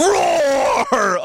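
high, drawn-out ROAR; mock roar. Showing co-host what it sounded like, mock roar on ROAR. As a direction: hold ROAR long like a bellowing wrestler. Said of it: "It sounded like he just said".

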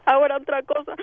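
normal talking voice

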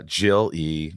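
ordinary talk, nothing marked

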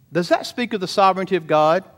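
ordinary talk, nothing marked

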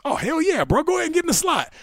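high, hyped-up "hell yeah"; gleeful egging on. Retold or imitated. Teammate egging Calvin into the slot, gleeful and excited. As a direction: cheer him on like it's the best idea ever. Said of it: "me thinking this is hilarious".

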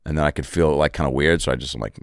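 ordinary delivery

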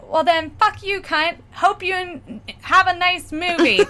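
high, mock-defiant comeback; retold defiant insult. Cursing out the woman to win, high defiant retelling.

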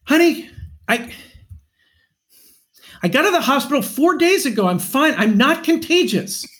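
high, exasperated husband without real shouting; mock argument, real voice. Shown as a demonstration. Husband demonstrating his staged trail argument, mock exasperation. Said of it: "let's hear what it sounded like".

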